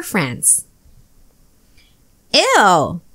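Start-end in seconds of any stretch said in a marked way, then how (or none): 2.3-3.0 put-on a kid's grossed-out "ew"; disgusted. Sid smells his baby brother's diaper, grossed out. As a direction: recoil from the stink as you say it.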